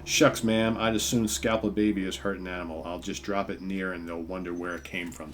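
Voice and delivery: lightly hammed frontier man talking to a lady; a hint of an old Western cowboy accent. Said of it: "He goes".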